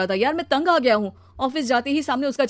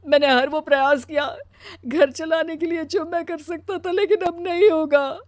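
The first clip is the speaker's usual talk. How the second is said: crying through the words, pitched up; tearful; 6 semitones higher, 5 dB louder than usual. Acted. Husband telling wife of office humiliation, crying. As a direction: pour out the humiliation in a tearful wail. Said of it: "वो रोने लगता है".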